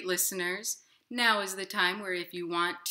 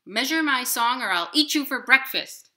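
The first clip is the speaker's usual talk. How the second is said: much louder menacing bird; threatening nightingale; 7 semitones higher, 12 dB louder than usual. Acted. Nightingale threatening to eat the inchworm, loud and menacing. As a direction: bark the threat. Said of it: "said the nightingale".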